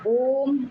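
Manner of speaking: ओम with the vowel held long; prolonged ओम. Shown as a demonstration. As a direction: draw out ओम like a chant. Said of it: "ओम प्लुत स्वर है".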